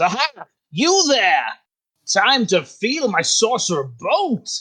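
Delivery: high, hammed-up battle cry; triumphant villain wizard. Wizard atop the beast challenging his target, grandly villainous. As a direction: call out a theatrical challenge.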